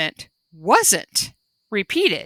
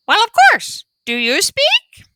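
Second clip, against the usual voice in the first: panda voice; 12 semitones higher, 4 dB louder than usual. A narrator's reading. Panda asks parrot brightly.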